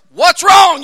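loud, high barked question; acted coach shouting; coach's yell. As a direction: yell it at a downed player. Coach questions winded player, acted.

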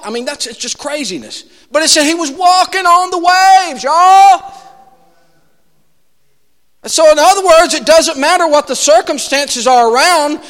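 high, loud pulpit preaching; preaching at full force. Acted. Preacher telling how Jesus walked on the waves, full-force preaching. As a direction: lift it up and drive it to the congregation.

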